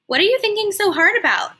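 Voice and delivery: a curious mother leaning in to her daughter; Mama's voice. Acted. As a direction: mom gently asking her daughter what's wrong. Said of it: "Mama asked".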